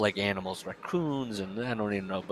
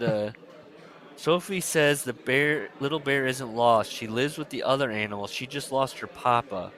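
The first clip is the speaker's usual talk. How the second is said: normal talking voice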